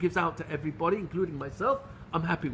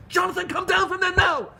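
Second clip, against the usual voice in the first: much higher, loud shouting of an alarmed mother; imitated scolding scream; 11 semitones higher, 5 dB louder than usual. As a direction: shout it like a mother frantic at her child's danger. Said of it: "she was gonna scream at me".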